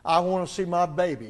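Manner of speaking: mother demanding her newborn; insistent demand. Retold or imitated. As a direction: insist on seeing the baby. Mother insists on seeing baby.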